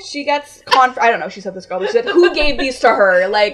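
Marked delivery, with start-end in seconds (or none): 2.1-3.3 loud, raised accusing question; borderline screaming. Retold or imitated. Worker asking who gave out forms, borderline screaming. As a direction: imitate an indignant worker calling out. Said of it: "borderline screaming at me".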